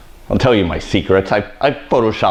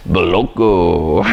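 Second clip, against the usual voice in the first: put-on slow, drawn-out Russian accent on Moloko; 2 semitones lower, slower than usual. Stylist jokes about her Russian accent, slow mock Russian. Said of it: "every time I try and do a Russian accent".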